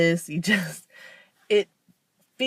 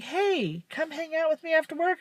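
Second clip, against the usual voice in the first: much higher, fast, perky invite; mock friend; 10 semitones higher, 4 dB quieter than usual. Friends invite her out after work, perky. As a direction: chirp the invite like an eager friend. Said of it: "my friends are like".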